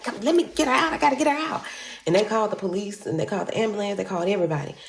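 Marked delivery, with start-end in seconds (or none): 0.0-1.6 high, urgent man at the car door; frantic rescuer. Retold or imitated. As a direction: pull her out, pitched way up and pressing. Man trying to free her.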